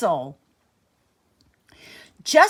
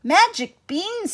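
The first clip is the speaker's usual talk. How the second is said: amazed; 10 semitones higher than usual. Acted. Jack amazed by the offer.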